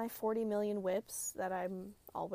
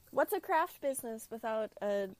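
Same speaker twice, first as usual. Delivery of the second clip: usual speaking voice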